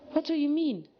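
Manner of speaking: high, bubbly, cheerful pony voice; innocent Pinkie voice. Acted. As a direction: explain it all sweetly, with no idea anything is wrong. Pinkie confused.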